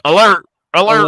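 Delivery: loud, high repeated warning; mock emergency announcement; pretend Alexa alarm. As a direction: blare it like an automated alarm. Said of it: "program Alexa to be like".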